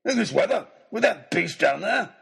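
high, indignant questioning; incredulous bluster. A narrator's reading. Baronet asked to climb over the shark, incredulous bluster. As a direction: balk loudly in disbelief.

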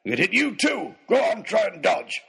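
high, blustering challenge; shouted taunt. A narrator's reading. Baronet daring his doubter to dodge, taunting shout. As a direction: goad them with a belligerent shout. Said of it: "ignoring his taunts".

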